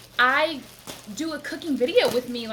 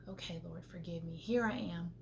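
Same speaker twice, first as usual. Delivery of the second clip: quiet prayer; 6 semitones lower, 13 dB quieter than usual. Retold or imitated. Prayer in a soft voice.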